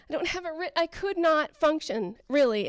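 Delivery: ordinary delivery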